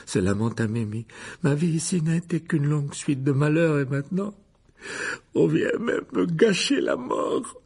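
a moaning ghost pitying herself; ghost Mimi. A narrator's reading. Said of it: "d'une voix sanglotante". (fantôme geignard et aigu)

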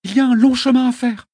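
put-on high, loud firm girl; young girl voice. A narrator's reading. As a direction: urge him to save breath. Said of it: "me dit Aîné".

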